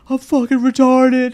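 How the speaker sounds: very high, slow, loud yelling; frantic yell. Retold or imitated. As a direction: yell it, hitting yourself in panic. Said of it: "punching himself in the face, going".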